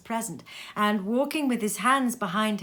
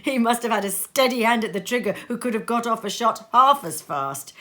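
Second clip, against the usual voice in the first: usual speaking voice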